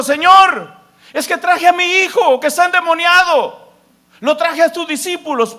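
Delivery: high, loud desperate plea; a father begging loudly, pitch pushed up; pleading father's cry. Retold or imitated. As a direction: cry out to Jesus for your son.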